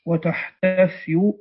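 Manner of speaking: وتحتفي slowly, with an undoubled y glide; وتحتفي slow and without hamza; light ya. Shown as a demonstration. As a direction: draw out وتحتفي, ending in a light -yu. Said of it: "بتخفيف الياء والحاء".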